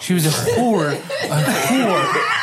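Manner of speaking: laughing. Real, not acted. Joking about the roommate.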